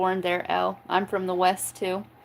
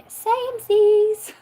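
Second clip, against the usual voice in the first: high, slow, drawn-out squeal; squeaky samesies; 13 semitones higher, 5 dB louder, much slower than usual. Acted. Agreeing with the chat in a high, slow squeal. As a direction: stretch it out way up high like a cartoon.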